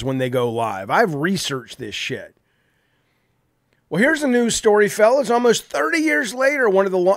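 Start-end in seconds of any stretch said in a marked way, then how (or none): 3.9-7.1 higher, perky anchor read; mock news host. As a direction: parody a scripted newscaster. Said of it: "I can probably do it better than they can".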